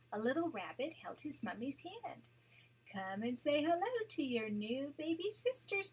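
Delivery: gentle read-aloud storytelling voice; storybook narration for a grandchild; story narration. A narrator's reading. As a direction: read it like a bedtime picture book. Grandma reads picture book aloud.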